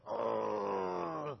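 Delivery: a soft pained ugh; mock groan. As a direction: groan like the disciples just got told off. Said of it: "They're like".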